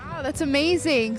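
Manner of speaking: ordinary talk, nothing marked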